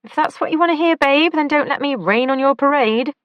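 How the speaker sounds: plain reading voice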